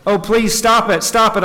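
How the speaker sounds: mild acted outcry; crying out, pleading. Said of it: "I cried".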